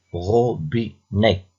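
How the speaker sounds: "robinet" slowly in three beats, ro-bi-net; slow, evenly separated "robinet"; split into syllables. Shown as a demonstration. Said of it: "puis écris le nombre de syllabes".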